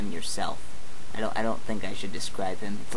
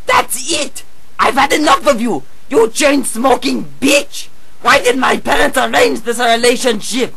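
much higher, loud hammy yelling, slowing on the insult; angry outburst; 12 semitones higher, 8 dB louder than usual. Acted. Mario yelling at his girlfriend in a hammy rage. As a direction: blow up in a cartoon rage.

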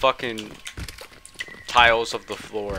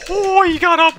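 sudden high yelp as the monster rises; jolted, voice jumping up and out; startled cry, much higher and louder; 8 dB louder than usual. Real, not acted.

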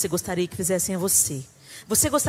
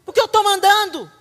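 much louder, higher bossy retort; bossy mom snap; 10 semitones higher, 10 dB louder than usual. Retold or imitated. Mother refusing to explain to her son, loud bossy snap. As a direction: cut the child off with authority. Parent snaps at child.

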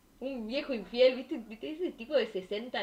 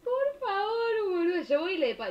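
crying laugh; 8 semitones higher, 4 dB louder than usual. Real, not acted. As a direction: laugh until it sounds like sobbing. Exaggerated reaction to paying. (risa llorosa, muy aguda)

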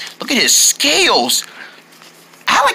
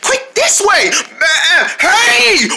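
high, breathless chase calls; excited shouting; 9 semitones higher, slower than usual. Acted. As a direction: yell while running.

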